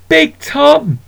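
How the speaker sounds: high excited call of the cat's name; delighted cry. Acted. Boy greeting the shop cat, high and delighted. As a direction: squeal his name with joy. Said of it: "he cried".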